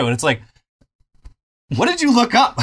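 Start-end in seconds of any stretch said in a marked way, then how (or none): 1.8-2.6 high, loud disbelief; baffled, pitch leaps up; incredulous. Real, not acted. Incredulous retort to moderator.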